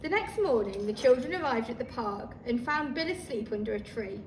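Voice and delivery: usual speaking voice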